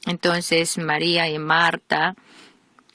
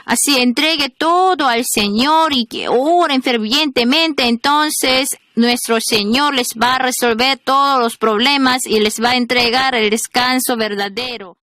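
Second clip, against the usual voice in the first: raised, louder pulpit voice calling out; fervent preaching; 6 semitones higher, 4 dB louder than usual. Acted. Pastor calling the congregation to rest in the Lord, fervent preaching. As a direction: preach it with full fervour to the crowd.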